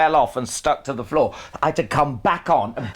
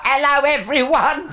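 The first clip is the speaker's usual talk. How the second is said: much higher, camp over-the-top greeting; exaggerated own voice; 11 semitones higher than usual. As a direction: ham up his own voice. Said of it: "imagine having this voice, Alan Carr".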